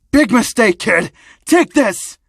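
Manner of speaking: loud, high battle yell; attacking shout. Acted. Thug fires electricity at the kid, shouting. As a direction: launch the attack with your voice.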